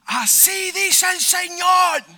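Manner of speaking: high, loud, drawn-out proclamation; slow, ringing shout from the doorway; prophetic shout. Retold or imitated. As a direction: cry it out like a prophet announcing God's word. Prophetic cry of the woman at door.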